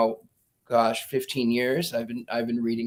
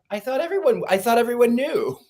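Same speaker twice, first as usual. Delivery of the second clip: higher, wide-eyed innocent protest; innocent child; 10 semitones higher, 4 dB louder than usual. Retold or imitated. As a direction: play the puzzled kid who thought everyone knew. Child answers scolding mother.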